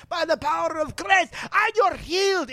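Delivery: high, booming faith-healer proclamation; imitating Benny Hinn healing someone; preacher impression. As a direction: call out the healing like a televangelist. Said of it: "and he's like".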